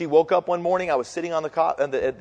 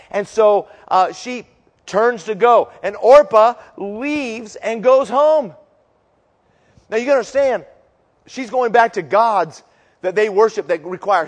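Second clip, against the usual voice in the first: loud, rising preacher's storytelling; preaching at full force; 6 semitones higher, 7 dB louder than usual. Acted. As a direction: drive the story home to the congregation.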